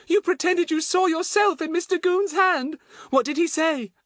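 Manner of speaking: very high, admiring little voice; excited Betts, delighted. A narrator's reading. Betts to Fatty about his story, delighted high voice.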